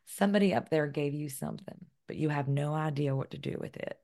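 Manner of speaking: imitated Southern professor; gently put-on Southern accent for a teacher's verdict. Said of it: "me doing a Southern accent".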